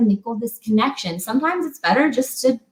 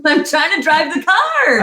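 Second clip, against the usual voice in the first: laughing mock of a strained husband, higher and slow; exasperated husband; 6 semitones higher than usual. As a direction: play the overloaded husband, half laughing. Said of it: "He's like".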